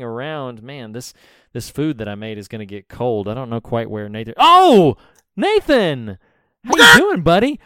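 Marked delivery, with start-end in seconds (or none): 4.4-7.6 loud high greeting; calling out. Acted. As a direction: greet him with a big shout. Greeting arriving cohost loudly.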